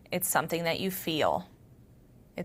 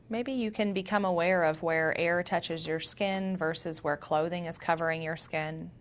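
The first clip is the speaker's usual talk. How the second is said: soft meditation voice with long pauses; guided meditation; 4 dB quieter than usual. Acted. Yoga teacher grounding listeners through their senses, guided meditation. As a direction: guide them gently inward, leaving space between phrases.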